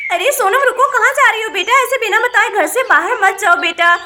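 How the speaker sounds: loud, high call to stop her; calling after child. Acted. Mother calls after runaway daughter, loud and high. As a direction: shout after the girl running out.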